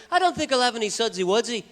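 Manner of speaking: put-on high, lilting British accent. Beatle declines a beer, lilting British accent. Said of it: "And he goes".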